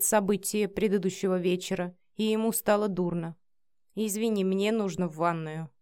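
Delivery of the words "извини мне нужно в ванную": plain reading voice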